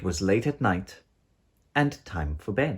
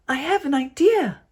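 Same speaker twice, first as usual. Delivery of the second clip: put-on high, loud cheeky child voice; scheming little girl; sly little idea; 7 dB louder than usual. A narrator's reading. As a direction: let her wink come through. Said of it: "she said with a wink".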